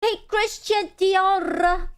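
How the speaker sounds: high, slow drawn-out hey; excited greeting. Real, not acted. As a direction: greet the new member with a long, high call. Greeting new member.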